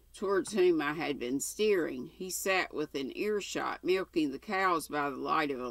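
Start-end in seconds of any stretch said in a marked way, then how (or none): none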